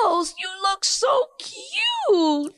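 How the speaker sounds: high, slow cooing at a pet; talking to a dog. Real, not acted.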